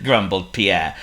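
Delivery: ordinary delivery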